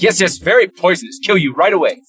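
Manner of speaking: a hint of a scolding local lady; stern hostel lady. Hostel lady warning the guests, lightly voiced stern. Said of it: "lady was like".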